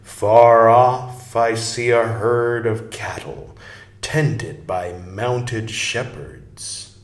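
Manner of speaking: slow, drawn-out storytelling read; stately narration with long, lingering words; slow read-aloud. Acted. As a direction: read it like a vision unfolding, savoring every phrase. Reads the book aloud slowly.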